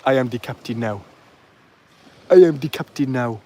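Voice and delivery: put-on Somali accent; Somali pirate impression. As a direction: imitate a Somali pirate taking over. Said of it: "We've got some Somali pirates".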